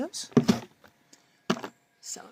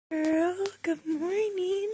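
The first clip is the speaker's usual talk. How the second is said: high pet voice; 8 semitones higher than usual. Real, not acted. Greeting the dog in the morning.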